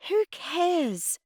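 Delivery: high-pitched dismissive defiance; defiant retort. A narrator's reading. Girl dismissing Mum's worry about gossip, defiant. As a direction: toss it back petulantly. Girl talks back to Mum.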